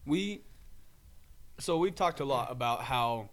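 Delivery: talking around an ice cube; words muffled by an ice cube; ice in mouth. Real, not acted. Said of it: "I have an ice cube in my mouth".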